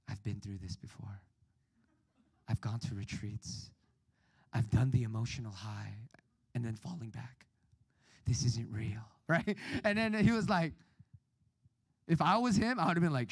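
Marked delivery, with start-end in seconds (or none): 0.1-9.0 low, much softer, brooding and self-important; brooding teen. Retold or imitated. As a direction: play the deep, wounded teenager. Brooding teen to youth pastor.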